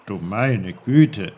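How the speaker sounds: a lightly voiced kindly old uncle; low, slow good-gracious old uncle. Uncle's familiar voice appears.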